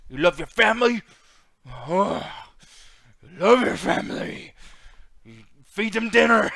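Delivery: loud, slow hammed-up threat; mock menacing promo. Mocking Samoa Joe's family promo with loud slow menace. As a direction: drag out the threat like a cartoon villain. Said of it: "his big thing is".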